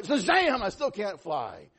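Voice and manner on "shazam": high, loud mock holler; louder retold shout. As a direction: shout the magic word with full hope. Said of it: "I gotta say it louder".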